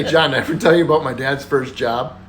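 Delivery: ordinary talk, nothing marked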